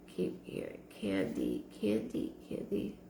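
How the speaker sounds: hushed, breathy repeat of the name; soft whispered echo of a name. Real, not acted. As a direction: whisper it as if listening for it. Hearing a name from spirit.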